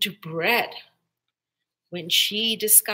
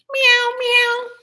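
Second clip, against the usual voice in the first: high cat voice ending in a meow; 14 semitones higher, 9 dB louder than usual. A narrator's reading. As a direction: mew it like a cat. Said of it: "said the cat".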